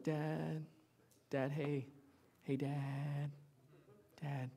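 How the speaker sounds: soft, timid half-hearted calling. Pretend. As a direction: mumble Dad quietly, no urgency. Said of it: "you don't just go".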